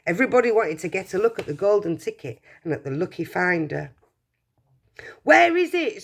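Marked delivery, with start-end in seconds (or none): 5.2-6.0 higher, louder crowd shout. Acted. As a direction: call out over the crowd. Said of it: "someone shouted".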